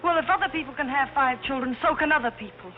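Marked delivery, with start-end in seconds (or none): 0.0-1.1 much higher, indignant squeal; indignant shriek. Acted. Indignant high-pitched retort.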